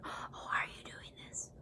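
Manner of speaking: very soft whispered question; barely audible mock whisper; imitated whisper. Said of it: "He is whispering".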